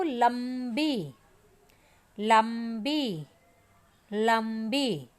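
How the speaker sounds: drawn-out, clearly syllabled लंबी for pupils to copy; teacher modelling लंबी slowly and clearly; slow clear model word. Shown as a demonstration. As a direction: stretch लंबी slowly, every sound distinct. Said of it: "तो आपने इस तरह से बोलना है उसको".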